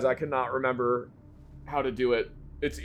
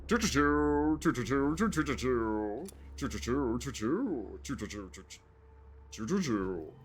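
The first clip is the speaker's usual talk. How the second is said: choo-choo getting softer; train choo-choo fading; 6 dB quieter, slower than usual. Pretend. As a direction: let the train fade away. Long train noise fading away.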